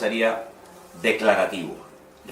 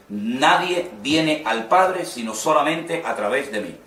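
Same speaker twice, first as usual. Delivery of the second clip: "nadie viene al Padre" drawn out, "Padre" weighted; slow emphatic; 2 semitones higher than usual. Shown as a demonstration. Teacher quoting Jesus to show an emphatic verse, slow and firm. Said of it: "de una forma enfática".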